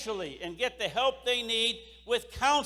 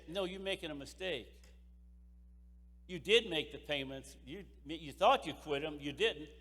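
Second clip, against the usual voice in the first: low and much softer, kindly correcting her; gentle reassurance; 6 semitones lower, 9 dB quieter than usual. Retold or imitated. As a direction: ease the widow's panic softly. Representative reassuring sobbing widow.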